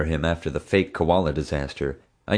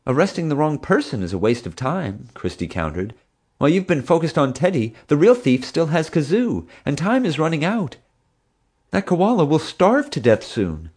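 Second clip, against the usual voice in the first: a lightly voiced earnest young woman, pitched higher; higher, insistent young keeper; young zookeeper; 9 semitones higher than usual. A narrator's reading. Christy counters Marge.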